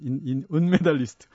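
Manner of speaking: stumbling over the word from nerves; tongue-tied, shaky start; nervous stutter. Real, not acted. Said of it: "발음까지 막 떨려요".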